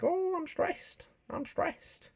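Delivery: very high, slow squeaky panic voice; high whispered squeal of fake panic; mock stressed squeal. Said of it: "I was like".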